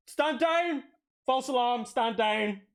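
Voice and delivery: high, loud military command; shouted order. Acted. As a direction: call off the troops at full voice. Sergeant calls off the alarm.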